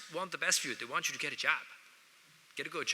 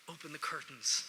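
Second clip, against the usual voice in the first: ordinary talk, nothing marked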